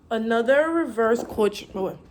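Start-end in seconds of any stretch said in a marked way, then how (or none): none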